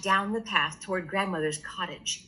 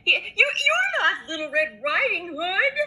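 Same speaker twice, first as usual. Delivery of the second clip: high, stammering fright; granny realising danger; much higher stammer; frightened grandma; 9 semitones higher than usual. Acted. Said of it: "she said".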